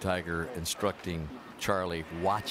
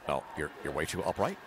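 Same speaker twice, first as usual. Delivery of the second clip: lightly put-on golfer's voice, slightly held back; a hint of a mimicked golfer coaching; bad Tiger impersonation; 3 semitones lower, 4 dB quieter than usual. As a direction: do a half-hearted impersonation of a golfer. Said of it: "my bad Tiger impersonation".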